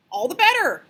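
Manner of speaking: put-on pleased old groundhog. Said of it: "said the groundhog".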